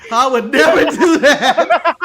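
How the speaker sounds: loud, slow, drawn-out protest through laughter; hammed-up outrage, cracking up; mock indignant refusal. Joking mock refusal, repeated after price.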